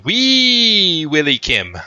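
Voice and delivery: mock Irish accent with a high, loud, drawn-out "Wee"; playful Irish accent. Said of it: "in an Irish accent".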